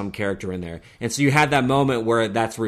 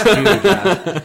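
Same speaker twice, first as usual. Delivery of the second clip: higher and louder, mock scolding; teasing jab; 7 semitones higher, 6 dB louder than usual. Real, not acted. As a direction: throw his name out loud, teasing. Teasing jab at friend's phone.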